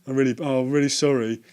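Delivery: apologising to the shop lady, hammed up; hammed-up apology. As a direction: lay the apology on thick. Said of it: "really ham it up".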